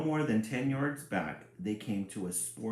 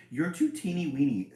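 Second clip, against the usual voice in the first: a louder, mocking big sister; 6 semitones higher, 6 dB louder than usual. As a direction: tease him from above. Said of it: "said Fancy Annie".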